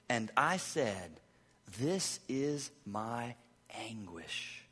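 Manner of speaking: soft, slow and weighty; slow somber reading. Acted. Pastor reading the turning verse slowly, somber. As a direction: let each word hang.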